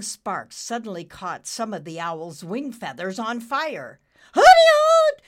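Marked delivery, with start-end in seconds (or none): 4.3-5.2 much higher, louder shrieking hoot; screaming owl. Acted. The owl shrieks as its feathers catch fire. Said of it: "the owl screamed".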